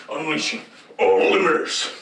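robot announcer voice. Acted. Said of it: "he goes".

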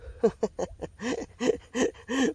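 rueful high laugh. Real, not acted. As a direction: laugh off the loss. Laughing at losing the fish.

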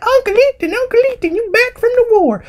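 put-on very high squeaky child voice; little children. Children greeting Uncle Ethan back from war, high mock child voice. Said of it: "all the little children up, like".

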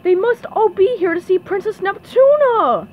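high, loud exclamation; dismayed cry. A narrator's reading. Character realizing why the crowd came, crying out loudly. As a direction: cry it out in alarm. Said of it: "he cried".

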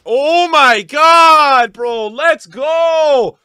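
long, drawn-out, loud cheer; jubilant shout. Real, not acted. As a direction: stretch the cheer out in triumph. Celebrates the pull.

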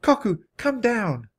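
high, drawn-out call; high-pitched beckoning call. A narrator's reading. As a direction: call slowly up to a cliff top. Annie calls up the cliff.

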